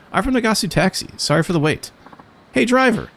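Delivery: high calling voice of a brash Kansai lady; lady passenger. Acted. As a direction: call out to the driver with gusto. Passenger lady hailing driver.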